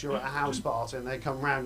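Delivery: ordinary talk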